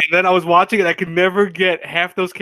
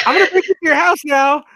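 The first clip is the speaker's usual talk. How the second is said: pitched-up, playful mock threat; high goofy character voice; 9 semitones higher than usual. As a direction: squeak it out like a cartoon stalker. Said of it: "he'd be like".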